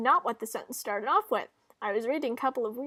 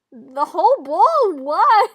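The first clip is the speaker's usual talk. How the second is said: high, booming outraged question; hammed-up loud incredulity; loud disbelief; 7 semitones higher, 16 dB louder, slower than usual. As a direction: cry out in shocked protest. Acted outcry over dip bowl.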